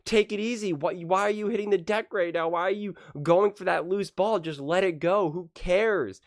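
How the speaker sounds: high-pitched exasperated mock yell at a player; worked-up retelling of yelling at the game; retold screaming. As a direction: plead loudly like a frantic fan at the screen. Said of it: "I'm screaming like".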